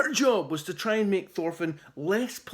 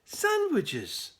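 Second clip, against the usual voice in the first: a bright, higher cheerful answer; cheerily; 8 semitones higher than usual. A narrator's reading. Polite boy answers the grumpy chief, cheerful. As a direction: chirp it happily. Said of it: "Thorfinn replied cheerily".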